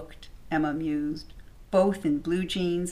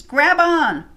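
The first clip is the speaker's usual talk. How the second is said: high, loud shout; 10 semitones higher, 10 dB louder than usual. Acted. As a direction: yell it to the man in the water. Rescuer shouts to drowning man.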